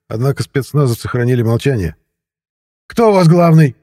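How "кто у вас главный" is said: high, loud demanding question; agitated persistence. A narrator's reading. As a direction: keep pressing, loudly and excitedly. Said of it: "не унимался Вячеслав Геннадьевич". (не унимаясь, громко и высоко)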